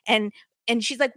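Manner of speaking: usual speaking voice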